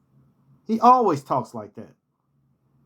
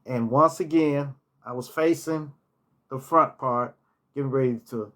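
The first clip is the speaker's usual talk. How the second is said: ordinary talk, nothing marked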